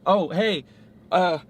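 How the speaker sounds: high, overeager awkward greetings; awkward repeated hey. Retold or imitated. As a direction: play someone nervously greeting everyone at a party. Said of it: "go around and say".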